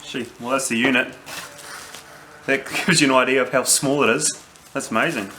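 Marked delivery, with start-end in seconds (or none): none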